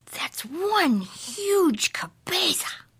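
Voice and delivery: high, slow, whispery awe; amazed kitty boy. A narrator's reading. Kitty boy measuring his head, awed. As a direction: draw it out in hushed amazement.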